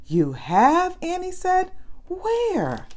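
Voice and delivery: surprised. A narrator's reading. Annie surprised picture found.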